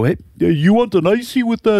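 high laughing put-on voice; mock vendor voice. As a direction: shout it as a joke vendor. Mocking a stereotyped vendor.

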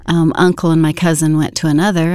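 ordinary talk

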